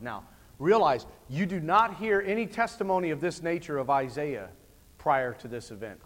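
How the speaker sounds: ordinary talk, nothing marked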